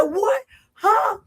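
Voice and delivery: high, cracking cry of shock; shocked disbelief. Retold or imitated. Told he hit the man, a high shocked cry. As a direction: gasp it out as if you can't believe it. Shocked by frying pan news.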